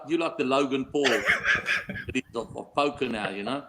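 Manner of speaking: normal talking voice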